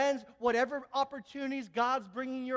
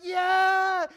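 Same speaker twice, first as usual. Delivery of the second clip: loud, higher crowd cheer; crowd yell; 8 semitones higher, 12 dB louder than usual. Retold or imitated. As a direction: shout it back like a crowd. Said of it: "everybody's like".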